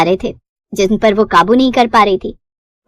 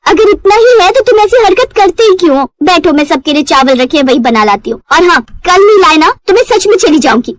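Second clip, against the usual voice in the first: higher, louder scolding; wife scolding her drunk husband, higher and louder; nagging wife; 6 semitones higher, 3 dB louder than usual. Acted. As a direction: tell off the late husband.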